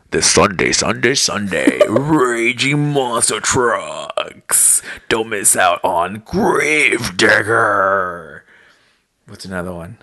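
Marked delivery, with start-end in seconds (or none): 0.1-8.4 slow booming hyped ad announcer; monster truck voice. Acted. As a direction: stretch every word like a monster truck radio ad. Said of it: "making my monster truck voice".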